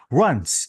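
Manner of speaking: "Runs" as one isolated beat; second syllable. Shown as a demonstration. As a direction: sound out "Runs" by itself. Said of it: "is the second syllable".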